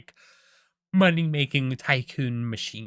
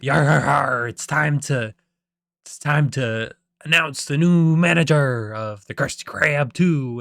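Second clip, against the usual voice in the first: loud, put-on European accent; Mister Krabs; 6 dB louder than usual. Crab boss announcing the new manager, loud mock European accent. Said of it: "I'm gonna do, like, a European accent".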